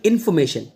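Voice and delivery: इन्फॉर्मेशन without R, "in-fo-me-shan"; silent R. Shown as a demonstration. Teacher modelling native speakers' इन्फॉर्मेशन, R left silent. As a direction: drop the R from इन्फॉर्मेशन, native-style. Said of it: "इस रा को मत बोलना".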